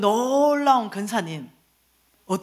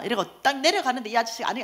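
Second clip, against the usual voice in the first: ordinary talk, nothing marked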